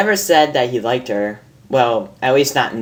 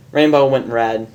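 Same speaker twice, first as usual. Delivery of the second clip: ordinary delivery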